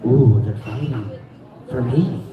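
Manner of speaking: low, dreamy purr of delight; swooning at the men fighting over her; girlfriend's voice. Retold or imitated. Girlfriend thrilled the tattoo guys fight over her, low and pleased. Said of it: "and she's like".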